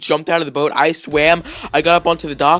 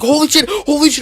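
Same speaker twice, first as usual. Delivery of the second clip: high, loud panicked cry; re-enacted scream, pitched way up; retold screaming; 13 semitones higher, 7 dB louder than usual. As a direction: act out screaming in terror at spiders. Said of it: "I was screaming".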